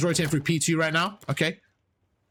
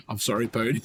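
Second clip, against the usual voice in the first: laughing apology; 6 semitones lower than usual. Real, not acted. Laughing apology after yelling wrongly.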